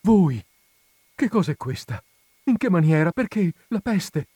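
high, loud, anxious questions; startled, worried Lucia; agitated questions. Acted. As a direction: fire the questions in alarm.

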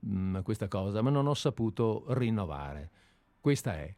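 usual speaking voice

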